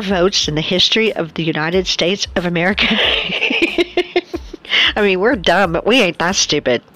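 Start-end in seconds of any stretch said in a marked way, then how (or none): 2.9-4.9 high laugh. Real, not acted. Laugh at absurdity.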